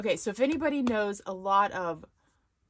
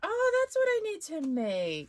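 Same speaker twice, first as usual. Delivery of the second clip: much higher, bright burst of discovery; light up as the idea hits; excited realization; 12 semitones higher, 4 dB louder than usual. Real, not acted. Excitedly spotting what to make next for viewers. Finds her next craft project.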